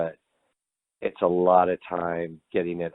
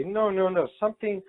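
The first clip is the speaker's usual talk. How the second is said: much higher imitated naysayer; mock objection; 12 semitones higher, 3 dB quieter than usual. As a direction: put on a high voice to mimic a rejection. Mimics executives rejecting his metal theme.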